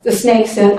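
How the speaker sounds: ordinary delivery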